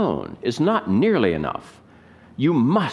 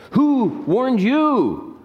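accusing prophet; 10 semitones higher, much slower than usual. Retold or imitated. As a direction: point and demand it slowly. John the Baptist accusing Pharisees.

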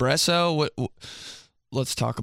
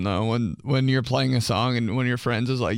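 ordinary talk, nothing marked

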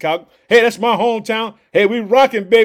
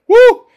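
high loud woo of celebration; excited cheer to the podcast family; whoop; 13 semitones higher, 10 dB louder than usual. Real, not acted. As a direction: let out a joyful high whoop. Hyped cheer at the crowd.

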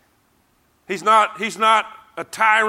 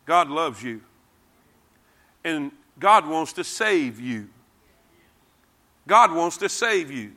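reach each listener gently, one by one; tender appeal; 3 semitones lower than usual. Real, not acted.

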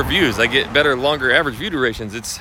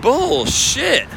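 scoffing; 12 semitones higher than usual. Retold or imitated. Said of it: "And I'm like".

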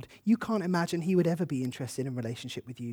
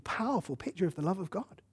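normal talking voice

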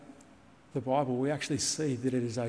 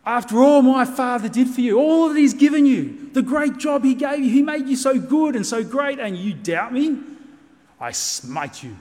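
much higher and much louder, a hammed-up angry Jesus; mock furious tirade; 9 semitones higher, 13 dB louder than usual. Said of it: "go into this tirade".

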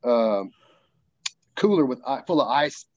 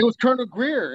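pitched up high, eager; excited; 10 semitones higher than usual. Real, not acted. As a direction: blurt it out in excitement. Realizing who arrived.

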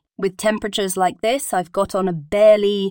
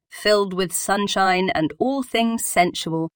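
ordinary talk

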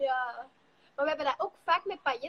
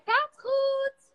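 high, loud chirpy all fine; imitating a cheerful singer after her fall; bright quote; 10 semitones higher, 6 dB louder than usual. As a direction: bounce back up and chirp it out. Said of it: "en die zei".

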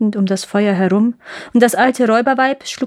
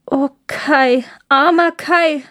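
mild, pitying sigh, higher voice; 6 semitones higher, slower than usual. A narrator's reading. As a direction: sigh the words with a touch of sorrow. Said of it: "seufzte Gerda". (leicht seufzend, mitleidig)

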